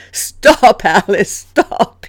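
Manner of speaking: helpless, loud pleading through laughter; laughing hard. A narrator's reading. Christopher begs Alice to stop her trick, laughing helplessly. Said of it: "laughing so hard that he had tears".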